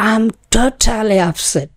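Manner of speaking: totally said with heavy aspirated T's; slow, breathy T in totally; I'm totally upset with slow aspirated T's. Shown as a demonstration. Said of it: "এই টকে কিন্তু আমি অনেকটা ঠয়ের কাছাকাছি বলছি".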